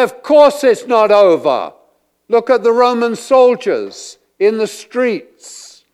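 loud, high, exasperated reply; indignant answer. Acted. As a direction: scoff at the obvious.

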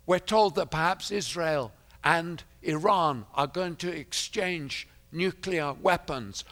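usual speaking voice

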